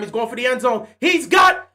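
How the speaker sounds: building from lively to a loud high cry as the pass flies; rising excitement. Real, not acted. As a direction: voice climbing with hope. Touchdown about to happen in game.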